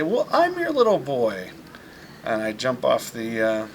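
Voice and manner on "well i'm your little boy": put-on higher and louder, a delighted little boy; triumphant reveal. Little boy proudly tells his mom who he is, gleeful. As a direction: burst out with the big reveal.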